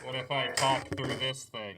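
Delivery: muffled through a toy walkie-talkie. Shown as a demonstration. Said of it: "walkie-talkie voice".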